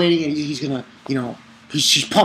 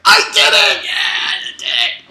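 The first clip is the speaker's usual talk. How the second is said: very high, strained victory cry; mock triumphant yell; 6 dB louder than usual. He tells everyone he passed, in a hammed-up triumphant yell. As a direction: squeal it out, imitating his triumph. Retelling his triumphant announcement.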